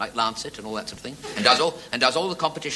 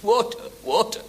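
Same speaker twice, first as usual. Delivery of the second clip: parched croak; 10 semitones higher than usual. Acted. Parched man begging for water.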